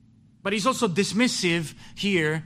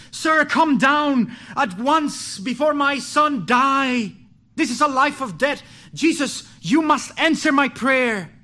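desperate pleading; 5 semitones higher, 5 dB louder than usual. Retold or imitated. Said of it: "he keeps pleading with the Savior".

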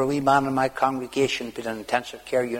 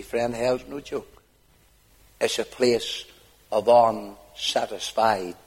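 usual speaking voice

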